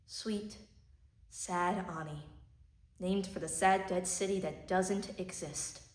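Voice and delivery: soft, hushed grief; tragic heroine sorrow. Acted. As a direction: speak low as if mourning. Monologue grieving for Ani.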